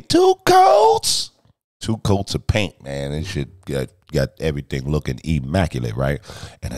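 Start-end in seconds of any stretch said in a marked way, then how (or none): 0.0-1.3 high, loud, slow shouted boast; mock shouted nickname; hype announce. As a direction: belt it like an announcer. Nickname punchline.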